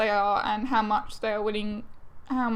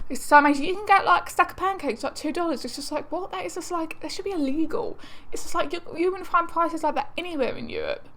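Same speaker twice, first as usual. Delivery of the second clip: higher-pitched, incredulous gushing; amazed excitement; 5 semitones higher than usual. Real, not acted. Vlogger amazed at two-dollar pancakes, excited and disbelieving. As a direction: marvel at it in a raised pitch. Marvelling at cheap pancake prices.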